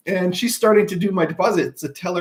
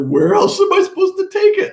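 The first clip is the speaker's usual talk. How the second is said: high, half-laughing disbelief; laughing exasperation; 13 semitones higher, 4 dB louder than usual. Retold or imitated. Husband retelling his question to the teller, laughing incredulous. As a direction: ask it in laughing outrage.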